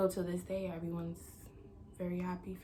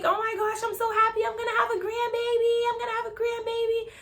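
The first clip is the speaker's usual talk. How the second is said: high, loud, squealing excitement; overjoyed mom; 9 dB louder than usual. Retold or imitated. Mom learning of her grandbaby, overjoyed squeal. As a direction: imitate mom bursting with joy.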